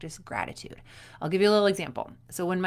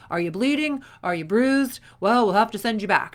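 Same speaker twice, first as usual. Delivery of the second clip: mock tough mom; 2 semitones higher, 8 dB louder than usual. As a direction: play the mom who shrugs off injuries, loudly. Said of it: "My mom used to pick me up at the gym and be like".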